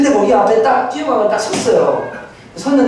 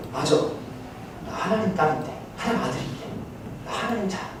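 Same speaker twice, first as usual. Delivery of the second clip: hushed self-talk; 11 dB quieter than usual. Retold or imitated. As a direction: murmur it to yourself with pauses. Tearful inner realization, voiced softly. (나직이 되뇌는 혼잣말)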